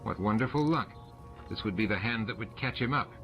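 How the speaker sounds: ordinary delivery, nothing marked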